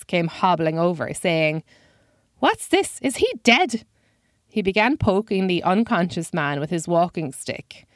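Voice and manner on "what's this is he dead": put-on very high, wild old man voice; high-pitched old man. A narrator's reading. Old man hobbling over to a fallen man, high-pitched voice. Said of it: "with a high-pitched voice".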